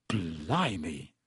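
low, faint, stunned exclamation; drained and awestruck; weak. A narrator's reading. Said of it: "said Ron weakly".

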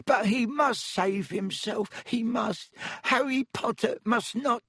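high, drawn-out elf moan; adoring, anguished high-pitched elf; miserable ecstasy. A narrator's reading. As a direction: gush mournfully in a squeaky elf voice. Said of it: "moaned Dobby in a kind of miserable ecstasy".

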